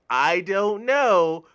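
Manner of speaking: slow, loud, high drawn-out denial; playing innocent kid. Kid playing dumb to his parents, slow loud drawn-out denial. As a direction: stretch it out like a guilty kid.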